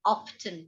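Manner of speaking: often with the t sounded, OFF-tun, falling; spoken t. Shown as a demonstration. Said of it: "Britishers होते हैं वो often बोलते हैं".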